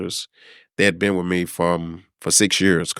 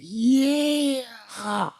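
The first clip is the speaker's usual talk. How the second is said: high, drawn-out gloating "Yeah"; smug "yeah"; 4 dB quieter than usual. Retold or imitated. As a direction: mimic rivals quietly pleased. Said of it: "kinda like".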